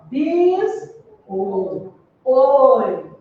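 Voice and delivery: "bis, o, oi" sounded out slowly, the "oi" said with a closed o; syllables split apart. Shown as a demonstration. Teacher sounding out biscoito for the class, closed oi. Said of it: "O som saiu aberto ou o som saiu fechado?".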